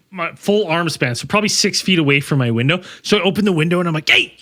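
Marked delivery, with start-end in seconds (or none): 4.1-4.3 high shout at a bear; shooing yell. Retold or imitated.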